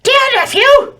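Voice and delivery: very high, loud sing-song hag voice; Auntie Hagatha's letter. Acted. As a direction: read it as a sweet old hag aunt.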